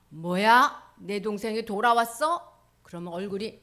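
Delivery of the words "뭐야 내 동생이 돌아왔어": annoyed surprise. Acted. Elder son reacts.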